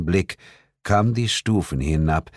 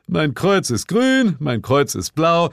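high, singsong-like teasing; joining the mockery; 10 semitones higher, 3 dB louder than usual. A narrator's reading. Bill joining in to tease the narrator, high-pitched mockery. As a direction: pile on with a silly high parody. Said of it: "schlug Bill in dieselbe Kerbe".

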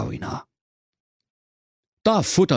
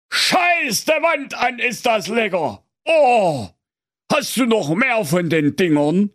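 delighted; 12 semitones higher than usual. Acted. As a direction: burst out in thrilled delight, pitched way up. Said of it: "da rief er entzückt".

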